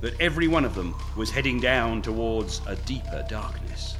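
dramatic storybook narration; story narration. A narrator's reading. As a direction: read it like an adventure novel. Reads his story aloud.

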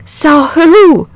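alarmed wife demanding to know; nervous terror. A narrator's reading. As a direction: catch the husband's fright. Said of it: "beginning to share in her husband's nervous terror".

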